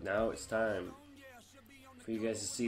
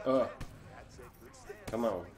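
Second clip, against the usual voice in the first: ordinary delivery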